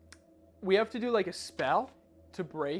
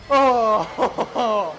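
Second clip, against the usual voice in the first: loud shocked oh with a laugh; startled laughing gasp; 3 semitones higher, 13 dB louder than usual. Real, not acted. Just after jump scare.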